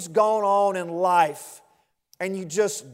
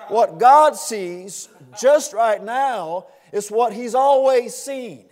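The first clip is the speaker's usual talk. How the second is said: louder, emphatic preaching; preaching at full force; 2 semitones higher, 5 dB louder than usual. Acted. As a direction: proclaim it, hammering each point.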